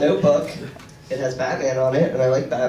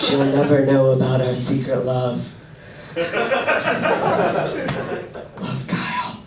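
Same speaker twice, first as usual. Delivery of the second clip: mock diary reading; 2 semitones lower, slower than usual. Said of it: "follow her around Walmart going".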